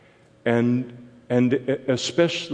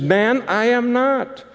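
much higher, slowed, insistent denial; Peter's denial; 12 semitones higher than usual. Pretend. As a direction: pull the voice up high and drag out the refusal. Peter denies again.

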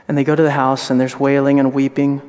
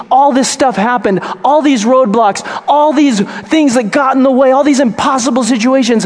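raised, higher, louder preacher's cry; preaching at full force; 10 semitones higher, 5 dB louder than usual. Acted. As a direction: preach it hard to the congregation.

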